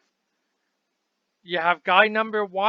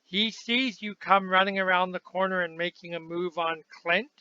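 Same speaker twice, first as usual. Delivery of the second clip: ordinary talk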